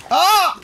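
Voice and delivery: high, loud yelp of fright; short piercing scream; startled scream. Real, not acted. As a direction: cry out at a sudden attack. Startled by attack in game.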